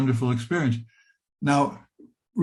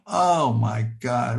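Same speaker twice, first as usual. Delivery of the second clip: ordinary talk, nothing marked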